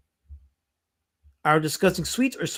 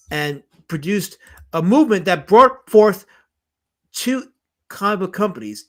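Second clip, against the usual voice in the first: normal talking voice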